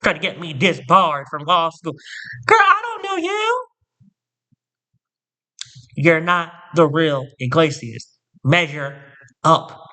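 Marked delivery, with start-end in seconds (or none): 2.5-3.7 high squeaky dismissal; dismissive diva. Retold or imitated. Professor brushing off the lying student, high and dismissive. 8.4-9.8 slow, drawn-out measure up; slow command. Real, not acted. Demanding followers measure up, slow. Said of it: "slow emphatic command".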